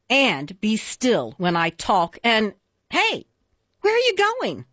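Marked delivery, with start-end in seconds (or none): 2.9-4.6 high, startled call after someone leaving. Acted. Bossy suitor calls after the fleeing listener, startled call. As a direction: call out suddenly, pitch jumping up.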